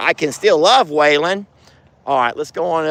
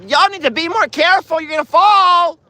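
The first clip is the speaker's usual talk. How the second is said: high, raised warning call; 4 dB louder than usual. Real, not acted. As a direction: holler up high to warn them. Calling a warning to others.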